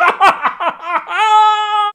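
high, loud maniacal cackle; evil laugh. Acted. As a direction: cackle like a mad villain. Villain laugh after boast.

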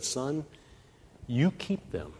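hushed and kind, handing back the pennies; tender reassurance. Retold or imitated. Man tells the ragged boy to keep his pennies, tenderly.

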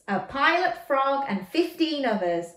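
ordinary delivery, nothing marked

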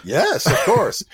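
high-pitched, emphatic acted agreement; eager yes. Actor retelling his reply to a job offer, eagerly.

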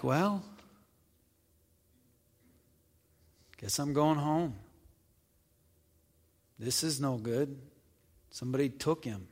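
soft, defeated shrug of a voice; dejected mumble. Retold or imitated. Disciples heading home from the empty tomb, softly defeated. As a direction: mutter it like a disciple giving up. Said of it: "And they're like".

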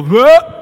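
loud, high mock retch; hammed-up throwing-up noise; mock vomiting. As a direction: hurl it out like a burst of verbal vomit. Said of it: "Let me verbal vomit over you".

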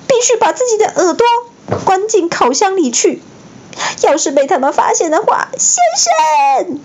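very high, fast elf voice; a tiny elf gushing at speed; squeaky house-elf. A narrator's reading. Dobby gushing to Harry, high and fast.

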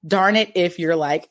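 ordinary talk

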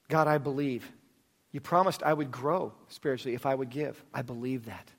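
soft, hushed prayer voice; quiet prayer. Acted. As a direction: pray it softly and sincerely. Leads a prayer.

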